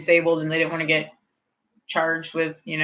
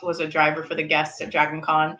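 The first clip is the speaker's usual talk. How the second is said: normal talking voice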